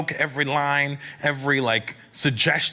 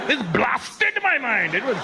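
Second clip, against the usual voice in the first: put-on high, slow Indian-accented insistence; imitated Indian fan, emphatic and drawn out; 4 semitones higher, slower than usual. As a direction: insist in a hammed-up Indian accent, stretching the key word. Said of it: "They were so emphatic with their compliments".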